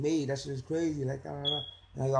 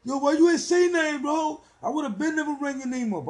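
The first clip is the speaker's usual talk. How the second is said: much higher, louder mock cop; friendly cop; 9 dB louder than usual. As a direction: play the cop scolding him like a buddy. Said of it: "He's like".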